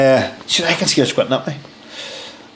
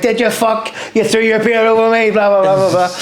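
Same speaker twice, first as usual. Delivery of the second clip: higher-pitched, incredulous telling-off; retold angry outburst; 7 semitones higher than usual. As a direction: imitate a sergeant major going nuts, without shouting. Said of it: "he's going nuts".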